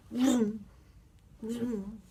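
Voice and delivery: high vroom noise for speed; playful vroom. Pretend. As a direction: zoom it like a race car for a child. Imitating a fast cheetah.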